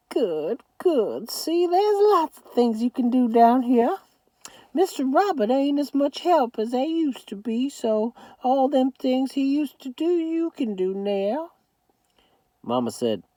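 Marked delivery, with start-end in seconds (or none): 0.1-11.5 put-on high old Southern grandmother voice; much higher grandma voice. A narrator's reading. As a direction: scold lovingly as a granny.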